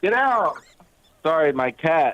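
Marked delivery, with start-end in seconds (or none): 0.0-0.6 shooing. Real, not acted. Shooing cat from shower.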